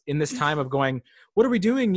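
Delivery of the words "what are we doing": high whiny voice of the bored; mock bored whine. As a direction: whine it like a restless person. Mocking bored people.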